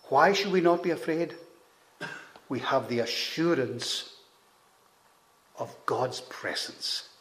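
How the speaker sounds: usual speaking voice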